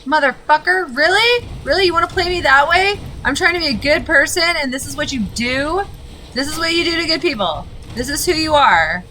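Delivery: loud, high, indignant shouting at the screen; furious yelling. Real, not acted. Gamer yelling at the game for punishing his good deed, furious. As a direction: rage at the game as if it betrayed you.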